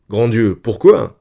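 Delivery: loud, drawn-out cry of protest; exclamation. A narrator's reading. Gérode answers his wife's regret at leaving Earth, exclaiming. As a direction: exclaim it loudly, stretching it out. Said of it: "s'écria Gérode".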